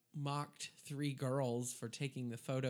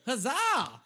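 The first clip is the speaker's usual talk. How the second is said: high, loud festival cheer "Huzzah!"; hearty mock-medieval huzzah; vendor's cheer; 9 semitones higher, 8 dB louder than usual. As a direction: shout it like a Renaissance fair vendor. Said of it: "they go".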